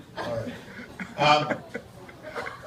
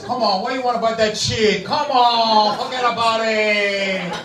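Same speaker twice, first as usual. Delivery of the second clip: high, hammed-up Puerto Rican accent; Puerto Rican Santa; 7 semitones higher, 5 dB louder than usual. Puerto Rican Santa dismissing a toy request, Puerto Rican accent. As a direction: draw out the end in a mock Puerto Rican accent. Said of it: "there was a Puerto Rican Santa Claus".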